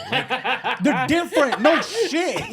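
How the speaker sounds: laughing while talking; amused, cracking up. Real, not acted. Laughing at the comparison.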